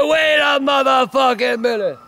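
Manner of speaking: slow, rising to a high shout; angry demand. Retold or imitated. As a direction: build from a warning to an outraged shout. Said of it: "He said".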